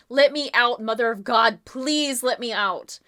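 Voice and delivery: loud, desperate cries through a door; a trapped man begging to be let out; yelling for help. Acted. Man locked in the closet calling the group, loud desperate yell. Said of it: "someone yelling and banging on a door".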